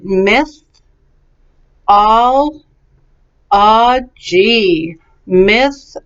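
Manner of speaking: "Mythology" as myth, ol, o, gy, with pauses; syllable by syllable. Shown as a demonstration. Teacher leads repetition practice, syllable by syllable. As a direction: give each beat of "Mythology" its own space. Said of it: "So let's do it".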